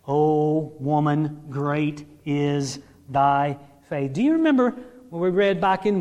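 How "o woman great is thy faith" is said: very slow, low, measured scripture; deliberate, lowered, reverent reading; solemn quotation. Retold or imitated. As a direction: weigh out each word of praise. Solemn re-quote of Jesus' praise.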